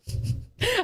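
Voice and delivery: high breathy laugh; startled giggle; burst of laughter. Real, not acted. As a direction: laugh in surprise. Laughing at cat appearing.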